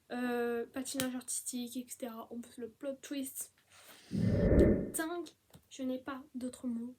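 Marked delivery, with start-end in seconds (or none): 4.1-5.3 loud exclaim then a whisper; stunned rave; amazed outburst. Real, not acted. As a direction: burst out then drop to a whisper. Raving about a plot twist.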